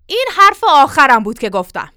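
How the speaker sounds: loud, final cut-off; angry last word. Acted. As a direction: shut the argument down forcefully. Mistress cuts off the servant.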